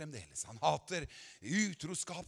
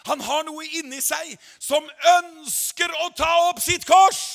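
building to a shouted climax; preaching at full force; 7 semitones higher, 9 dB louder than usual. Acted. Preacher rousing the congregation, building to a shout. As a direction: drive it home louder and louder.

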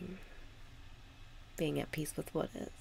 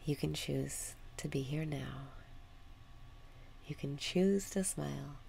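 soothing breath-work guidance; guided meditation. Acted. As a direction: ease them into the moment with long pauses.

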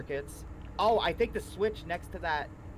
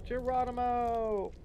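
playful battle cry; 7 semitones higher, slower than usual. Real, not acted. Jumping down elevator shaft.

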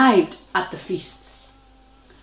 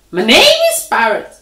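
squeaked; 3 semitones higher, 7 dB louder than usual. A narrator's reading. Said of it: "Parrot squeaked".

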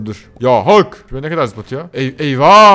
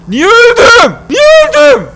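very high, loud cartoon squeak; squeaky mock whine; 11 dB louder, slower than usual. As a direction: squeal the question like a cartoon character. Ölünce cırtlak sesle yakınıyor. (cırtlak, yüksek sesle yapmacık sızlanma)

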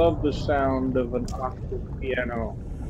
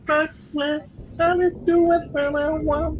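screaming. Retold or imitated. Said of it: "I'm screaming out".

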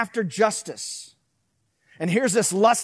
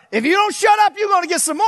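higher, louder angry parent; hammed-up threatening folks; mock yelling parent; 10 semitones higher, 6 dB louder, faster than usual. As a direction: bark it like an old-school parent. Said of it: "My folks would be like".

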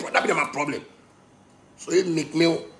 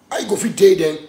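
ordinary talk, nothing marked

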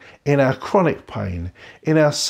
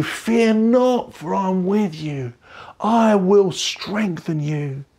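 higher, slow, tender reassurance; God comforting; 5 semitones higher, slower than usual. Retold or imitated. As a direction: speak as God soothing a hurting child. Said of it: "He's whispering".

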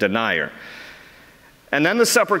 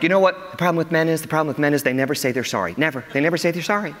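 complaining ladies; 3 dB quieter, faster than usual. Retold or imitated. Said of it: "The ladies are like".

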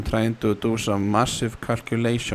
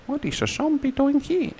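high startled question; alarmed squeak. Real, not acted. Gamer startled hearing a zombie nearby. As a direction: shriek it in sudden alarm.

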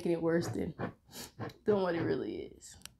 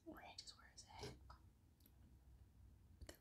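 whisper; 30 dB quieter, faster than usual. Real, not acted. Aside to camera.

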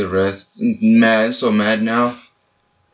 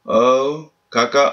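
normal talking voice

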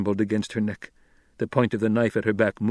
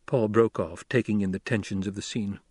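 ordinary delivery, nothing marked

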